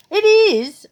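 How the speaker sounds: protesting she watches for the story, laughing; laughing insistence. Real, not acted.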